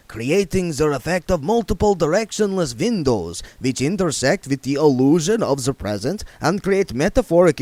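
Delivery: put-on lecturing vampire accent; dismissive expert explaining; pompous vampire. A narrator's reading. Otto lecturing.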